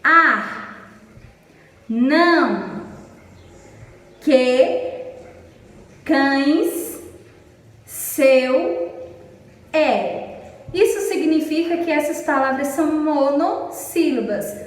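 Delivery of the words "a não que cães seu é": "A, não, que, cães, seu, é" each isolated as one deliberate beat; one-syllable demonstration. Shown as a demonstration. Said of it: "Vou repetir. Olhem pra boca da tia".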